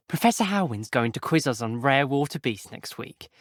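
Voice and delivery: ordinary talk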